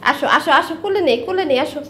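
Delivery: cooing come here, come here to a pet; coaxing a pet bird. Real, not acted. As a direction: coax the little bird onto your lap. Coaxing the pet myna again.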